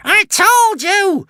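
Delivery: very high, loud, slow cackling screech; gloating witch shriek. A narrator's reading. The witch crowing that she was right, loud shriek. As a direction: crow the triumph at top voice. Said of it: "hissed the witch".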